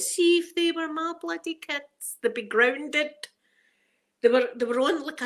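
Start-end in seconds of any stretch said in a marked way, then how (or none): 0.0-3.1 higher, slow, disapproving mother; indignant thought. Retold or imitated. Watching rude kids on skateboards, indignant. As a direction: tut at the kids like a strict mum. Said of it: "and I thought".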